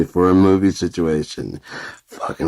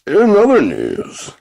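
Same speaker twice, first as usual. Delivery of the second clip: high, loud, slow announcer voice; mock news anchor; 10 semitones higher, 4 dB louder than usual. Kid playing newsreader, high loud mock anchor voice. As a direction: intone like a cheesy newsreader. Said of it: "always one of the change of things".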